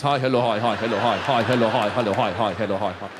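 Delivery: descending mock greeting; bowing greeting.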